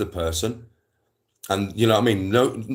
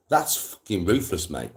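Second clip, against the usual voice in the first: ordinary delivery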